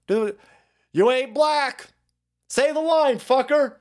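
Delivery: high, pushy mock demand; mock goading. Heckling demand to perform catchphrase.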